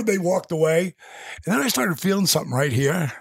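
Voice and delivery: usual speaking voice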